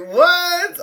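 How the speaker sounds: very high squeaky "what?"; high-pitched mock shock; amused disbelief. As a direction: shoot the pitch way up, incredulous.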